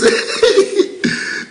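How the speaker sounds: a high, airy burst of laughter; high breathy laugh. Real, not acted. Laughing at his own hey hey.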